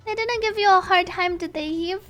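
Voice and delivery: concerned singer. Acted. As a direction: check on a friend with lifted voice.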